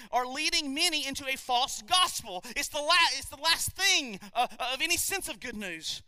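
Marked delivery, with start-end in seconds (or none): none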